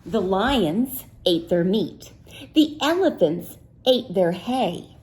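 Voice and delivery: usual speaking voice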